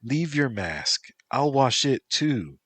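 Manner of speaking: high, sing-song reassurance. Acted. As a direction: soothe him like a little child.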